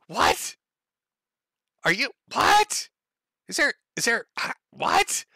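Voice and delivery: high incredulous what; mock bafflement. Real, not acted. Mocking the poster with high baffled whats. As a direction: play dumb, pitch way up.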